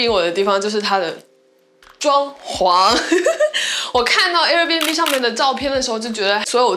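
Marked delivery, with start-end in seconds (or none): 3.0-3.9 a quick bright giggle, pitched high; short laugh. Real, not acted. Showing viewers the bathroom, a quick high giggle. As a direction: laugh it off lightly.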